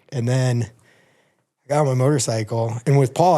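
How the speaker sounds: normal talking voice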